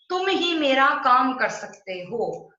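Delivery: ही said loud and slow; stress on ही. Shown as a demonstration. Teacher showing students the emphasis on ही. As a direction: lean hard on ही. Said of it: "ही शब्द पर जोर आया".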